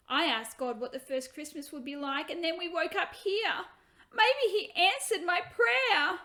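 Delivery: put-on a child amazed her prayer came true; hopeful little girl. Hannah explains her prayer.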